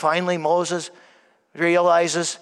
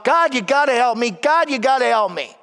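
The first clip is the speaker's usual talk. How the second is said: mild shout; 5 semitones higher, 3 dB louder than usual. Retold or imitated. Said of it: "this man just keeps shouting out".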